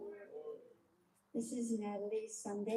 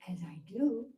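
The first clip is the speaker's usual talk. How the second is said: guided meditation. Acted. Leader talking listeners through a calming visualisation, guided meditation. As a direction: guide listeners into calm, phrase by measured phrase.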